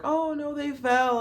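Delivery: high, loud mock-worried gasp; hammed-up fake concern at a fallen kid; mocking everyone. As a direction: squeal it like a fussing bystander. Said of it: "And then everyone's like".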